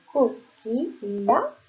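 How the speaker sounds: coquilla drawn out syllable by syllable; slow sounding out. Shown as a demonstration. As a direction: stretch coquilla slowly for the class. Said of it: "puis de la lettre I".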